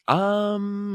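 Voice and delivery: long, high drawn-out um; mock hesitation. Actor reading a jazz drums brief, high doubtful stalling.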